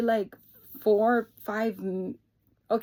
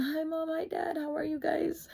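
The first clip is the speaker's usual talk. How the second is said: high, softer tearful hello; retold tearful greeting; 9 semitones higher, 4 dB quieter than usual. Daughter greeting parents after crying, retold tearful greeting. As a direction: imitate a small, high greeting through tears.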